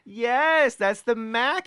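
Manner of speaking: high, thrilled confirmation; excited recognition. Real, not acted. Excited reaction to surprise reveal.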